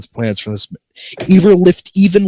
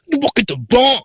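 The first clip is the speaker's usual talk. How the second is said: Big Smoke voice; 13 semitones higher than usual. Pretend.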